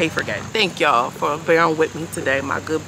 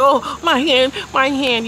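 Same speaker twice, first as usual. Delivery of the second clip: higher, louder hammed-up moaning; mock pained whine; 8 semitones higher, 5 dB louder, slower than usual. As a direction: overplay the hurt hand, mocking. Mimicking Duke clutching hand.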